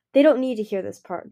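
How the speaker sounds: ordinary delivery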